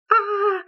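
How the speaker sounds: short high mock shout; a quick high yelp like the picture; shouting sound. Shown as a demonstration. Teacher demonstrating the shouting mouth picture, short and high. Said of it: "That person is shouting".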